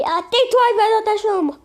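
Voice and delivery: put-on high, loud shout sending the kid to her room; angry mother yell. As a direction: bark the order like a furious mum. Mother yells at daughter.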